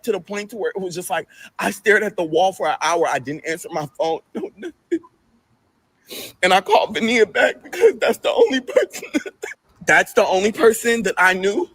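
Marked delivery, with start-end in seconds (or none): 4.3-11.7 pained laugh. Real, not acted. Laughs through grief.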